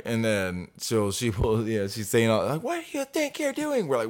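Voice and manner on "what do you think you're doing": very high, lightly mocking voice; imitated irate woman. Resident challenging the workers, high mocking imitation. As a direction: mimic an indignant complainer way up high.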